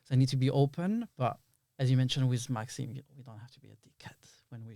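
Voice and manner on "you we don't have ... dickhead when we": fast whispered aside; quick whisper. Real, not acted. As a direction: whisper it quickly, almost to yourself.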